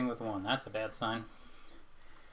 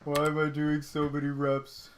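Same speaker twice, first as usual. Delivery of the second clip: mock complaint; 2 semitones higher, 5 dB louder than usual. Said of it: "And I'm like".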